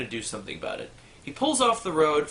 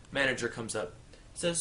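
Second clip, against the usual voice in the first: ordinary delivery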